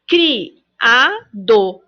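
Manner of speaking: "Criado" split into CRI, A, DO; slow teacher's cri... a... do; syllable by syllable. Shown as a demonstration. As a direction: count out "Criado" in three separate syllables. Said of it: "Criado tem três sílabas".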